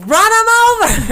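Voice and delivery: high, loud mock New Yorker yell; mock shout. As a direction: yell it like an aggressive New York driver. New Yorkers yelling at pedestrians.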